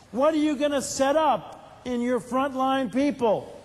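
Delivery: normal talking voice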